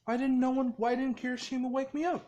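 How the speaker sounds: high, loud, acted indignation; indignant complaint. Just-woken character blames his friend for forgetting him, indignant. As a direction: demand an answer, pitched well up.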